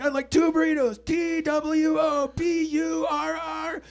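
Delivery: high, loud, slow letter-by-letter shout; spelled-out shout. Retold or imitated. Bitchy friend shouting the order at the speaker box, slow and loud.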